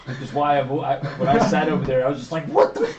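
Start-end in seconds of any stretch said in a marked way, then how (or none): 2.5-2.9 high, loud mock disbelief breaking into laughter; shouted disbelief with a laugh in it; incredulous outburst. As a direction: re-enact a stunned yell, much higher and louder. Acting out own past reaction.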